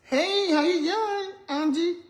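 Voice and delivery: high, drawn-out call across to a friend. Real, not acted. Greeting Angie as she passes, calling out. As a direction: sing out a hello.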